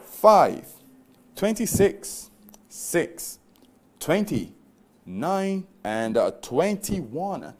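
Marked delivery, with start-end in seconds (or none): 0.0-3.4 booming number call. Acted. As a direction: call each number out big. Live lottery number call.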